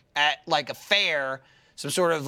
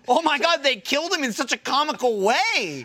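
amused disbelief; 8 semitones higher than usual. Retold or imitated. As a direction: marvel at how silly it is. Verbaasde lachende reactie.

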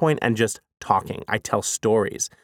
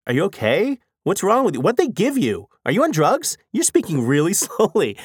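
higher-pitched, incredulous questions; mock concern; 6 semitones higher than usual. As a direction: imitate baffled friends checking on you. Facebook friends' alarmed reactions, acted out.

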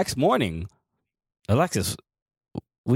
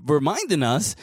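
ordinary talk

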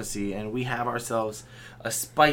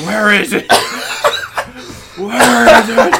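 Batman yell; 11 semitones higher, 14 dB louder than usual. Pretend. Batman shout bit.

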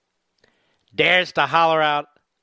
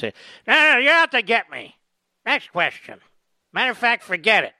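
put-on higher, louder indignant old-man whine; hammed-up Biden brushing off the press; mock Biden; 7 semitones higher, 5 dB louder than usual. Host mocking Biden snapping at a CBS reporter, high indignant whine.

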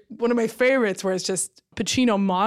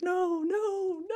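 mock ecstatic moan; 10 semitones higher, 6 dB quieter, slower than usual. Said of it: "orgiastic pleasure is like".

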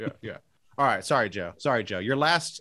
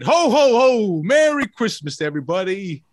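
higher, louder jolly Santa farewell; Santa sign-off; 4 semitones higher, 4 dB louder than usual. Acted. Host signs off as Santa, a big ho ho ho. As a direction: boom it out like Santa leaving. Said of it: "That's what it sounded like".

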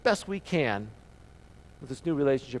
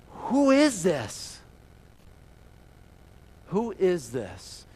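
slow wondering question; much slower than usual. Acted. The city asks about Jesus.

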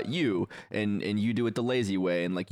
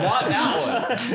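laughing; 10 semitones higher, 5 dB louder, much slower than usual. Real, not acted. Laughing protest repeated.

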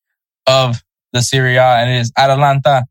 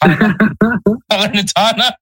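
laughing; 7 semitones higher, much slower than usual. Real, not acted. Struggling with a name, laughing.

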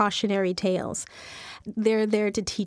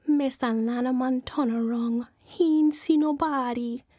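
plain reading voice

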